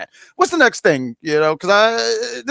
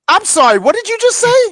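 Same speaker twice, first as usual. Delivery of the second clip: mock disbelief; 13 semitones higher, 3 dB louder than usual. Mock outrage at co-host.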